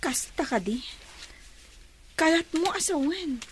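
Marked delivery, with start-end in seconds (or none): 0.0-0.8 whisper. Acted. Teasing her quietly about a suitor.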